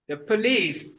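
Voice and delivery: ordinary talk, nothing marked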